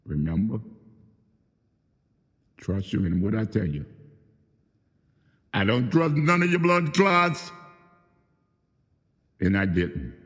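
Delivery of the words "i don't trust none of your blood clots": high, louder burst of fury; angry shout at the ones who betrayed him; angry outburst. Real, not acted. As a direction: spit the distrust out, loud and high. Angry accusation, voice jumps up.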